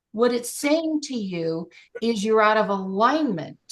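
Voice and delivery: usual speaking voice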